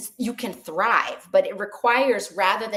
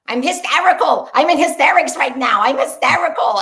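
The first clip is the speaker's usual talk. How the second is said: loud, high hysterical outburst; hammed-up movie panic, pitched up; mock hysterics at full volume; Gene Wilder; 5 semitones higher, 8 dB louder than usual. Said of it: "The Producers with Gene Wilder".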